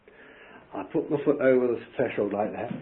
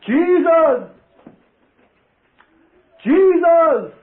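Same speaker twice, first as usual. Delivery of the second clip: high, loud fervent cry; shouting Jesus; 12 dB louder, much slower than usual. Retold or imitated. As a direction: call out the name with all you have. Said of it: "And I shouted".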